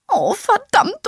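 slow, high, wailing curse; voice rising an octave. Acted. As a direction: start the wail already high. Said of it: "ihre weiche Stimme hob sich um eine Oktave".

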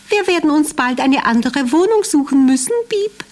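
high squeaky mouse, lamenting; complaining little mouse. Acted. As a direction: whine it like a worried mouse. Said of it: "klagte Zappelschwänzchen".

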